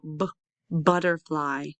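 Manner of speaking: short b, pause, then butterfly; sounded-out onset. Shown as a demonstration. Teacher tests the first sound, b apart from butterfly. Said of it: "Do you hear the N sound".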